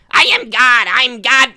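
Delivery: a quick, half-hearted try at Sheen; brief mock cartoon-boy voice; Sheen impression. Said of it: "I'm thinking of Sheen".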